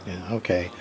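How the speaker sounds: ordinary talk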